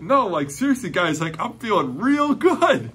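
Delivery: a lightly voiced thrilled girl; excited insistence. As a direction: convince doubting friends joyfully. Healed girl insists to friends.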